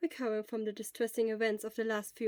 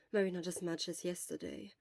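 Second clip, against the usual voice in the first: usual speaking voice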